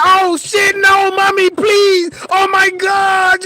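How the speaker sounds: high panicked shout, pleading please; desperate begging. Retold or imitated. Kid pinned by brothers begging mama, panicked high shout. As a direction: beg for mercy, voice cracking up. Brothers holding him for whooping.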